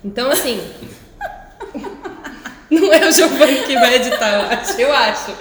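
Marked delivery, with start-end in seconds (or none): 1.6-5.3 laughing out loud; loud real laugh while talking. Real, not acted. Laughing loudly.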